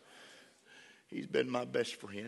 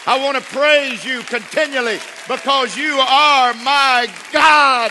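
loud, high, fervent preaching cry; full-force preaching; 13 semitones higher, 19 dB louder, slower than usual. Acted. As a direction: preach it out with all your strength to the church.

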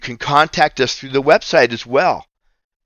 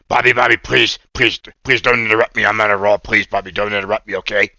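eager little puppet pleading not to be cut off; lightly voiced puppet boy, on a roll; a hint of a cartoon puppet, insistent; Oscar puppet voice; 3 semitones lower, faster than usual. Puppet Oscar interrupts host.

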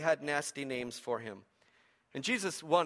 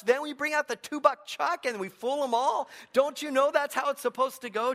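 high, loud scolding host; indignant party host; 7 semitones higher, 5 dB louder than usual. Retold or imitated. Party host scolding the servants about the wine, high and loud. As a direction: scold him like a fussy host who knows the rules. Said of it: "And, and he says".

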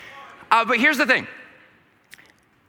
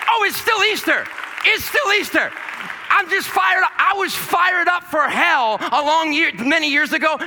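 preaching at full force; 8 semitones higher than usual. Acted. Fired-up preaching.

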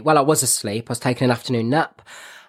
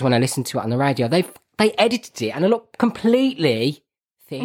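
ordinary talk, nothing marked

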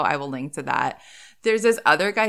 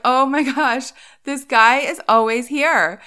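raised, loud mock astonishment; amazed retelling; 6 semitones higher, 5 dB louder, slower than usual. Telling of seeing the Target guy every day, amazed. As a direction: exclaim it higher, in retold amazement.

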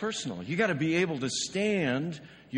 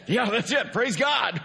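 laughing, higher and louder, all relief; relieved laughing cheer; 9 semitones higher, 6 dB louder than usual. Retold or imitated. Pastor imitating the relieved parents, laughing louder and higher. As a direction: let the relief burst out with a laugh.

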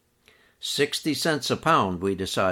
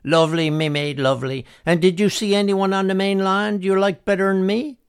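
Harvey voiced; 10 semitones higher, 6 dB louder than usual. A narrator's reading. Harvey teases the narrator.

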